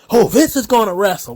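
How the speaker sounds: mocking hype. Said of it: "These motherfuckers on the internet".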